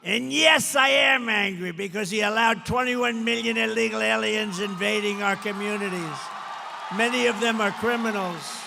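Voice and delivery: rally crowd hears him roar he's angry; angry shout. Real, not acted. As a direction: shout it out, declaring his anger. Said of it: "I'm angry".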